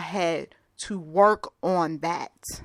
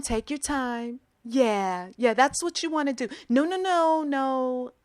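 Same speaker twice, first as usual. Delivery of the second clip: high, sugary fake-patient coaxing; overly sweet patronizing; 6 semitones higher than usual. Impatient young worker hiding frustration, syrupy coaxing. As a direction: coax her like a toddler. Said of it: "had to cover it up with".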